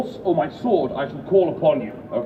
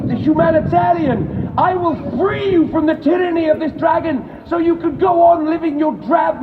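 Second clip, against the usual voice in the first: pompous knight. Acted. Knight's boastful speech to crowd.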